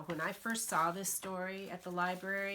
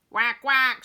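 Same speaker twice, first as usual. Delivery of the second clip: loud protective quacks; mother duck guarding her babies; mama duck quack; 6 semitones higher, 16 dB louder than usual. Acted. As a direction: quack to keep people away. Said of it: "she said".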